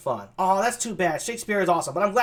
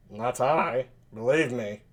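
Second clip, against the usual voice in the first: low, soft nasal voice; lightly nasal, lowered character voice; nasally Benedick; 6 semitones lower, 5 dB quieter, slower than usual. Acted. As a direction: say it low through the nose. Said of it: "kind of a nasally sound".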